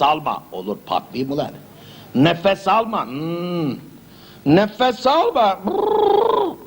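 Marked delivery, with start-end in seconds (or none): 4.5-5.6 very slow, high nefes alma; slow drawn order. Acted. Doctor making patient hold breath, slow and high. 5.7-6.6 high puffing brrr from held breath; strained lip trill. Acted. Patient bursting after holding breath, lip trill.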